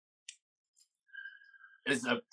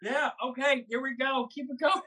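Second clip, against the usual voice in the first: high psyched-up; 11 semitones higher than usual. Real, not acted. Psyching up for hot wing.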